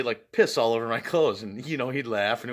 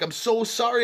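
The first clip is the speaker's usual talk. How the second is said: panicked apology; 10 semitones higher, 4 dB louder than usual. Retold or imitated. Apologising to angry stranger.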